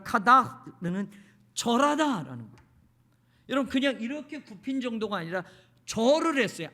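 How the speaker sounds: ordinary talk, nothing marked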